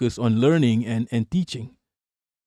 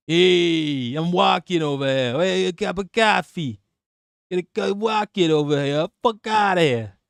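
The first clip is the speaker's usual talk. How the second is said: hammed-up Italian accent, higher in pitch; mock Italian accent; 3 semitones higher than usual. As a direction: stretch the vowels like a stock Italian caricature. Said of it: "we got an Italian in the house".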